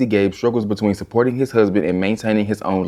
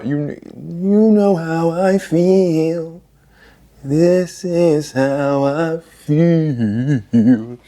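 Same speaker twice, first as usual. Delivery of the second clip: very slow, higher, dragged words; drawn out; 6 semitones higher, much slower than usual. Acted. Telling viewers how she feels about satire: slow and drawn out. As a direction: stretch every word for effect. Dramatizing her feelings on satire.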